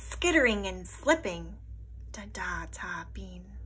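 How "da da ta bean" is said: whisper. A narrator's reading. Echo of ice words.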